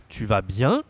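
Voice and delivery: "tu vas bien" rising at the end; rising question tune. Shown as a demonstration. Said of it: "raise your voice, of course".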